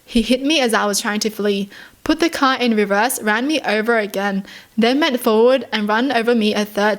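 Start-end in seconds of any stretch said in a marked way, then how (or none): none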